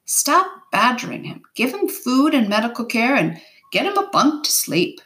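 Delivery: brisk captain's reprimand, understated; barked order. A narrator's reading. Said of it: "one man barked".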